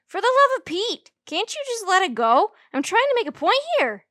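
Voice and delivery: high, loud exasperated cry; exasperated outburst. A narrator's reading. Junie B losing patience with her teacher, high and loud. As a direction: throw the words up in frustration. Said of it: "I said".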